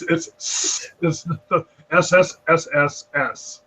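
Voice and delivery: drawn-out hissing s sound; hissed like a snake. Shown as a demonstration. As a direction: hiss the S like a snake. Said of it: "did you literally just hiss".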